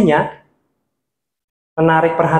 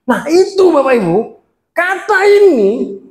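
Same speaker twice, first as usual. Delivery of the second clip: "kata ini" pitched very high and drawn out; high emphasis; 8 semitones higher than usual. Shown as a demonstration. Speaker shows word emphasis to preachers, high-pitched stress. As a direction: lift the key words high and stretch them. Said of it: "ada yang tinggi".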